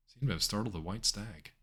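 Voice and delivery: ordinary talk, nothing marked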